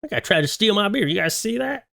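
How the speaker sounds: high, fast whiny protest over his beer; hammed-up tipsy beer defender; mock drunk guy. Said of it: "He's like".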